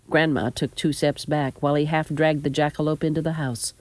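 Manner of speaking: ordinary delivery, nothing marked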